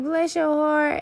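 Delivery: sugary mock-sweet Southern blessing; sarcastic Southern Belle. Mocking the people she dislikes, sugary Southern Belle sweetness. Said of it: "that's code for, you know, Southern Belle, fuck you".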